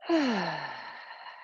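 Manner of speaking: long falling sigh on the exhale; open-mouth sigh. Shown as a demonstration. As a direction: let the breath fall out audibly. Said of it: "and sigh it out".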